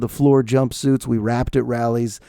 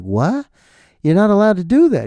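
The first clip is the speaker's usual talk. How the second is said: higher, incredulous protest; retold as a scandalized objection; mock indignation; 7 semitones higher, 3 dB louder than usual. Podcaster scolding producer over wine in a mug, mock indignation. Retelling shocked reply to producer.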